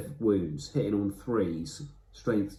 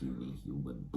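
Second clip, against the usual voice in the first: much lower and quieter, sneering; big contemptuous knight; 9 semitones lower, 11 dB quieter than usual. Retold or imitated. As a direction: look down on a tiny foe. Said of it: "And he goes".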